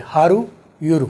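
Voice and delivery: slow, each word हारू and यूरू said apart; slow drill of हारू, यूरू; separated practice. Shown as a demonstration. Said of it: "अब दो अलग अलग बोले".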